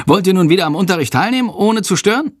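high-pitched, brisk pointed question; strict teacher. A narrator's reading. Teacher to both pupils in the corridor, strict. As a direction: ask pointedly, pitch climbing at the end.

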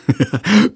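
high acted giggle; high-pitched mocking snicker; giggling burst. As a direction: burst into teasing laughter. Pupil bursts out laughing.

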